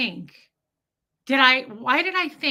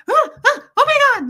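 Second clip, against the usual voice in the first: high, loud panicked shriek; freaking out; 10 dB louder, much faster than usual. Retold or imitated. Sister panicking on the phone, loud high shriek. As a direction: imitate her freaking out in a shrill burst. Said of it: "she freaks out".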